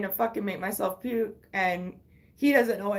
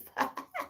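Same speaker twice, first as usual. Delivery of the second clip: breathy high giggle; 5 dB quieter than usual. Real, not acted. Laughing at mom.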